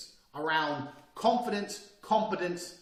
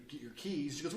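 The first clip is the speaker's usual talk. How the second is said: ordinary delivery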